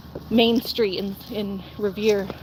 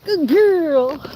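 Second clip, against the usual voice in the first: higher, louder singsong praise; praising pet voice; 6 semitones higher, 4 dB louder than usual. Real, not acted. Praising her husky in high singsong. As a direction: praise the dog sweetly.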